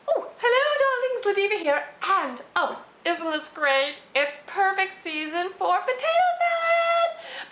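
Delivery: high, drawn-out diva greeting; campy drag queen. Acted. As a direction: gush it like a glamorous drag diva.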